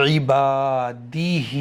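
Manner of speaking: इबादिही with the ही drawn out one alif, दि short; slow recitation, holding only the final ही; Quran recitation demo. Shown as a demonstration. Teacher reciting slowly so students hear the long ही. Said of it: "ध्यान से सुनिएगा".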